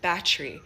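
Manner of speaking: "battery" squeezed into two syllables, "bat-tri"; condensed Jamaican pronunciation. Shown as a demonstration. Giving another example of patois words, condensed Jamaican pronunciation. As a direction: drop the middle vowel of "battery". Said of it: "condense it to battery".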